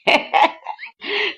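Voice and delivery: high, real burst of laughter; amused laugh. Real, not acted. Storyteller amused by two people confessing love, laughing. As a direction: laugh out at the lovers.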